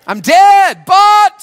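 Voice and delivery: high, loud rallying shout; loud call for the crowd to echo. Shown as a demonstration. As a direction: shout it to get them going. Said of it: "Say it".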